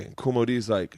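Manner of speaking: usual speaking voice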